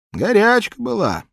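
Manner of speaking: put-on high, loud old man voice. Old man tells of illness.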